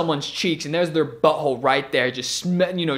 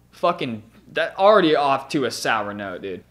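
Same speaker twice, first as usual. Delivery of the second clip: normal talking voice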